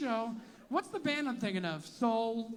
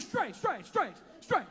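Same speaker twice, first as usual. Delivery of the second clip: slightly higher, hurried denials; mock straight guy; 4 semitones higher, slower than usual. Comic imitating straight guys waving off the question. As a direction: insist quickly with a hand wave. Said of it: "They do, like, a hand".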